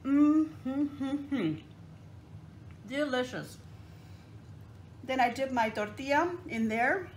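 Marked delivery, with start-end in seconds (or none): 0.0-1.6 savoring hum. Real, not acted. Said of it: "Let's take a big old mouthful". 2.9-3.6 mouth full. Real, not acted. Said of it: "Let's take a big old mouthful".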